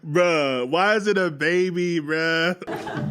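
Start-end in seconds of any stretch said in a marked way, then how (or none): none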